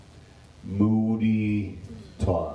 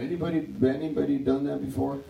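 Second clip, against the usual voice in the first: rushed aside; 4 semitones higher, much faster than usual. Real, not acted. Quick asides to congregation.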